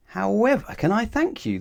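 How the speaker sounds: high, lightly voiced grateful farmer; a relieved farmer gushing thanks. Farmer thanking Mister Strong, high and relieved. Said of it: "the farmer asked".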